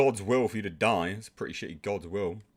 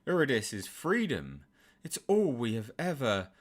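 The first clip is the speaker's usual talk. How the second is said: usual speaking voice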